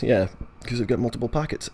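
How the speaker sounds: usual speaking voice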